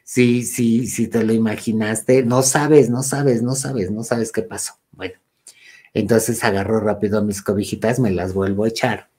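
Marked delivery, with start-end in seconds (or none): none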